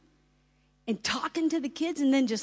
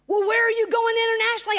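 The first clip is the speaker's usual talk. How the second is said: high, fast and louder skeptic; mock skeptic; 11 semitones higher, 6 dB louder, much faster than usual. A skeptic presses the speaker, pitched high and quick. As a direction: fire the question fast and high.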